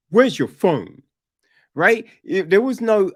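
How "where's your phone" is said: loud, curt dad's question; imitating an uncaring dad, louder; brusque demand. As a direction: fire off the question with no concern.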